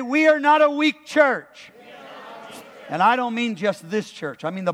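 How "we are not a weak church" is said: high, forceful rallying cry; loud declaration. Real, not acted. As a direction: proclaim it for the church to echo.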